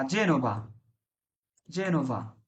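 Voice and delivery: "জেনোভা" opened with a j sound; soft g pronunciation. Shown as a demonstration. Teacher gives an example, "জেনোভা" with soft j. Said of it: "জি এর পরে এ আছে".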